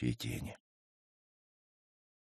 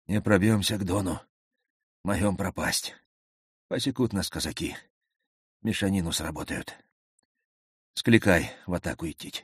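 wheezing; 2 semitones higher than usual. A narrator's reading. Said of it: "засипел командиру отряда на ухо".

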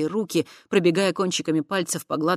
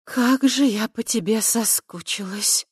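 slow, higher, sighing with longing; breathy longing sigh; 6 semitones higher than usual. A narrator's reading. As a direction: breathe it out, missing him. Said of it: "вдруг выдохнула она".

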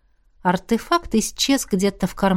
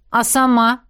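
higher, wounded comeback; stung retort; 4 semitones higher than usual. A narrator's reading. Izergast answers Miranda's reproach, stung. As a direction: throw the question back, a touch stung. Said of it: "спросил уязвлённый Изергаст".

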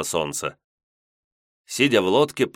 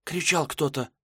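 put-on hushed girl voice; 9 semitones higher, 4 dB quieter than usual. Maiden hears a cry.

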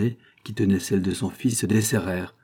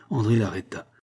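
usual speaking voice

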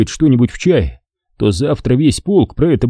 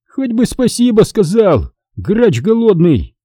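higher mock shout after him; a raised call at a departing freeloader; 10 semitones higher than usual. A narrator's reading. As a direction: yell after him as he leaves. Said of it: "крикнул ему вдогонку Хасан".

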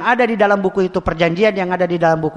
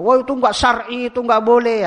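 mock protest; 7 semitones higher, 4 dB louder than usual. Imitating arguing with the official.

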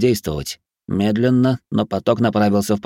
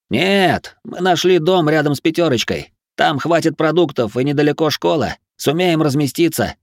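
high, raised voice; firm, raised-pitch objection; insistent arguing; 7 semitones higher than usual. A narrator's reading. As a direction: push back hard in a heated dispute. Heated argument in a crowd.

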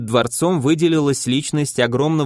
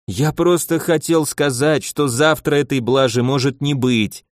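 normal talking voice

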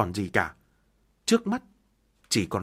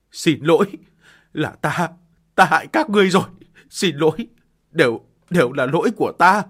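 louder, tearful cry; crying apology; 3 semitones higher, 8 dB louder than usual. Acted. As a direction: beg forgiveness in tears. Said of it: "gào khóc".

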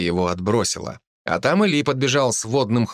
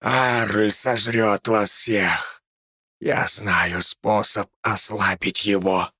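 seething spirit voice; much slower than usual. Acted. Possessed spirit threatens, slow.

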